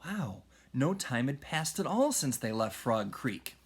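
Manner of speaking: plain reading voice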